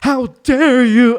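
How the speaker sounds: high, loud, slow indignation; drawn-out, hammed-up protest; mock outrage. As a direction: imitate someone theatrically offended. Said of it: "my man is pissed".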